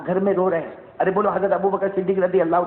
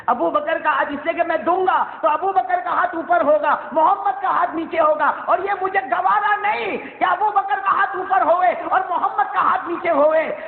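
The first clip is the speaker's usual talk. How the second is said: fervent preaching; 11 semitones higher than usual. Acted. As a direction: preach it at full force, voice raised high. Preacher rises to fervent pitch.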